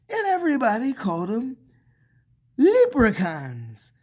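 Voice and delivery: plain reading voice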